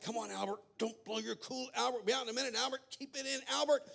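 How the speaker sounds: urgent pleading, barely keeping it together; dad losing composure. Acted. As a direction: play a frazzled father trying to stay cool. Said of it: "the dad is just like".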